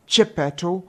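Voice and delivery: plain reading voice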